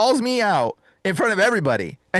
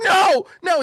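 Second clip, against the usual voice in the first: high-pitched shouted protest, imitated; mock panicked shout; panicking; 13 semitones higher, 3 dB louder than usual. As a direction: act a demon crying out in panic. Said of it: "He starts panicking".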